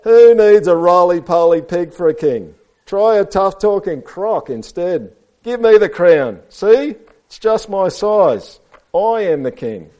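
slow, loud, high storybook boasting; pushy read-aloud croc; tough-talking crocodile. A narrator's reading. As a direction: throw your weight around loudly. Reads Crocodile's speech to children.

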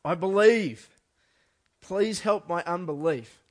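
loud, high, desperate cry, slowed on the plea; anguished shout of faith. Retold or imitated. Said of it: "he cried out".

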